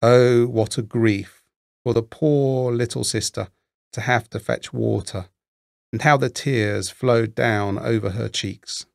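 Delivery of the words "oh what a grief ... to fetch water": ordinary delivery, nothing marked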